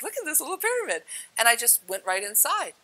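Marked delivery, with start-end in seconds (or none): 0.0-1.0 high, quick little squeal of interest; delighted discovery. Retold or imitated. Retelling finding a tiny personal size pyramid, quick high delight. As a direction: rush it out, pitched up. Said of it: "And I'm like".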